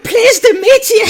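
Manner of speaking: very high, loud mock-girlish squeal; hammed-up little dwarf girl shout; female dwarf. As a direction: squeal it out, on the edge of laughing. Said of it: "talk like a female dwarf".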